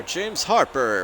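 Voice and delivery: high, slow, drawn-out name call; ring announcer. Shown as a demonstration. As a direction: stretch the name like a boxing announcer. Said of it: "ring announcer introduction".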